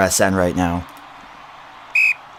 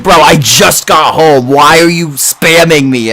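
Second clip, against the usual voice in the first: louder, slow mock outrage; hammed-up annoyed friend, higher; retold friend's complaint; 8 semitones higher, 9 dB louder, slower than usual. Streamer imitating his friend's reply, mock-annoyed and loud. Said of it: "He's like".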